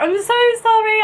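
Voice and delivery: high apologetic gush. Retold or imitated. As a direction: plead sorry earnestly. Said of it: "I was so apologetic".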